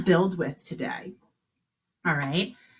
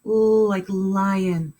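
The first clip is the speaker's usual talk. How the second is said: "L" held long, then "like lion" slowly; slow letter sound; much slower than usual. Shown as a demonstration. Teacher slowly models the L sound for kindergartners. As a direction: drag out "L, like lion" for the kids. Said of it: "What letter makes that sound?".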